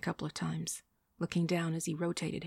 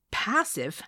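higher, louder, indignant echo; too loud; 4 semitones higher, 5 dB louder than usual. A narrator's reading. Woman echoing her date's word, too loudly. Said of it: "too loudly".

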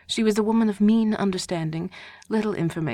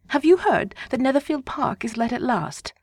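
higher, louder gossiping wife; eager wife; 6 semitones higher, 4 dB louder than usual. A narrator's reading. Wife telling Mr Bennet news, eager higher voice. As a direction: spill the news to him.

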